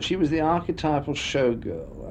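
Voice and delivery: ordinary delivery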